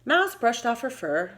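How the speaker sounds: ordinary delivery, nothing marked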